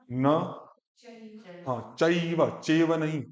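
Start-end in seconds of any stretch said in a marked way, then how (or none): none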